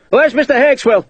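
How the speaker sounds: high, loud shout. A narrator's reading. Dodd calling for Hakeswill, angry shout. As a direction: demand the deserter come now. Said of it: "he shouted".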